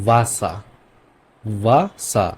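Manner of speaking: "ভাসা" starting between ব and ভ; German W as V. Shown as a demonstration. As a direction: model "ভাসা" with a German V sound. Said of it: "আর ভ এর মাঝখানে". ("ভাসা" ব আর ভ-এর মাঝামাঝি উচ্চারণে)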